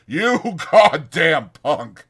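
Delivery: slow, laughing contempt; scornful laugh. Acted. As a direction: laugh him off as a punk. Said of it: "Carlson laughed".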